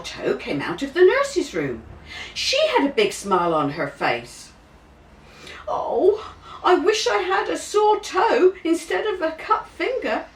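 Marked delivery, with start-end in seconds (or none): 5.7-10.3 put-on envious child. A narrator's reading. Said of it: "thought James".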